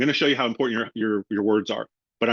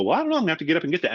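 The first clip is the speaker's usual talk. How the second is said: hurried customer; 5 semitones higher, much faster than usual. Retold or imitated. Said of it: "people go".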